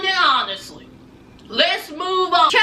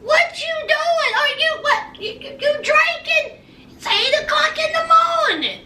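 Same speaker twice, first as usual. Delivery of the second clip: shocked mom yelling; 9 semitones higher than usual. Retold or imitated. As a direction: yell at her like a shocked mother. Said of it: "Amber's mama said".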